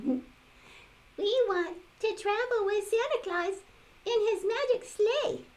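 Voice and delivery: sing-song high toy doll voice. Acted. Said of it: "said the misfit doll".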